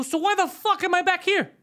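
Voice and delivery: very high, loud exasperated yell; retold furious outburst. Driver retells blowing up in the garage, mock furious yell. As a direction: lose it at the other driver. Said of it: "So I fucking lose my mind".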